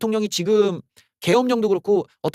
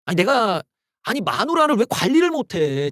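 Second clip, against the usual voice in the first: normal talking voice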